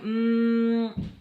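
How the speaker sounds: long, level droning hum; imitated dial tone. Dialing the phone at Sam's, humming the tone. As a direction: hold one steady note like a phone line.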